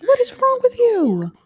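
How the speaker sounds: squeaky high outraged protest; exasperated disbelief. Real, not acted. Player appalled as the uncle apologizes, high disbelief.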